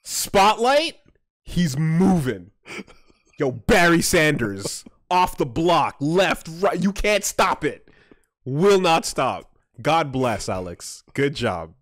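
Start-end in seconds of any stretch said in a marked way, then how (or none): 0.0-9.5 hyped play-by-play call; sports commentary. Acted. Mock play-by-play of the runner.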